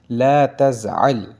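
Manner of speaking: ला तज़अल said slowly and loudly; slow model phrase. Shown as a demonstration. Teacher modelling the Arabic phrase slowly and clearly. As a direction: sound out "ला तज़अल" slowly for students. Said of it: "ला यानी ला यानी मत या नहीं".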